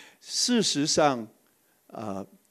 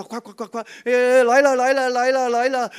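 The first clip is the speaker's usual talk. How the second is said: frantic rushing; 9 semitones higher, 6 dB louder than usual. Retold or imitated. Father rushing to crying twins.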